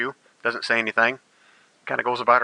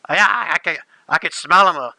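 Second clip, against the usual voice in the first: Nimba's voice; 5 semitones higher, 4 dB louder than usual. Acted. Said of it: "It says".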